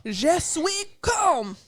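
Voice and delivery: high, slow, self-consciously bad French; bad French attempt. Shown as a demonstration. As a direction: try the French and butcher it. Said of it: "I'm bad at it. Here I go".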